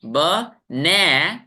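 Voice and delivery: ब-ने- with ने stretched and lifted up; short ब, then push ने upward; rising stressed syllable. Shown as a demonstration. Teacher shows voice going up, stressed second syllable. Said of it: "ऊपर गया ना".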